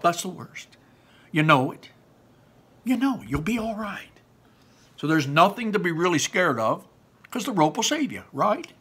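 coaxing Barley. Acted. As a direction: cheer him on gently.

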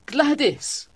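high-pitched call of her name; crying out. A narrator's reading. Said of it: "I cried".

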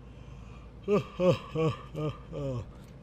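an evil little laugh mid-yawn. Real, not acted. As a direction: cackle through a yawn. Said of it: "That was me yawning".

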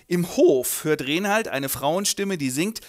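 normal talking voice

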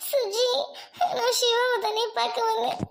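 sobbing, halting account; crying. Acted. Girl telling her friend about the boyfriend, crying. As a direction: tell it brokenly, dissolving into sobs. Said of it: "Jenny, அழுகாத".

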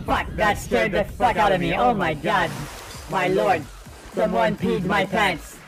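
ordinary talk

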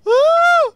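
loud, very high-pitched squeal; excited squeal. Real, not acted. Player reacting to getting a dog, excited squeal.

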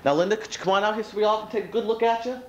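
lightly voiced game show announcer; TV host. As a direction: introduce her like a TV presenter. Hosts a mock TV show.

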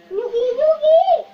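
high, loud, drawn-out nyugi; exasperated calming shout. Real, not acted. Girl begging her wriggling dog Pogi to calm down, high and loud. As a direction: plead with the dog to calm down, loudly and slowly.

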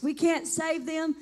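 usual speaking voice

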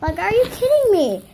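retelling it with mild outrage; can't believe the nerve of it; exasperated. Retold or imitated. Exasperated at being blocked.

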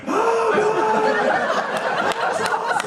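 very high, gushing reaction; mock-delighted squeal; delighted onlookers. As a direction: imitate people cracking up at the story. Crew react to the story.

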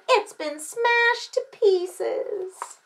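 high, very slow lament; drawn-out storybook distress; wailing child. A narrator's reading. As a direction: stretch it into a kid's wail. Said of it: "shouted little Chan".

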